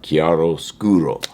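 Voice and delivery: chiaroscuro again, slow, with a falling finish; Italian word. Shown as a demonstration. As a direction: pronounce chiaroscuro kee-ah-ro-SKOO-ro, drawn out. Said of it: "That's what that means".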